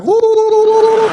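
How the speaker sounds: very high, loud warbling whoop; mock war whoop. Imitating what Americans think Indian means, mock war whoop. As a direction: whoop in a caricatured war cry. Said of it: "they think you mean, like".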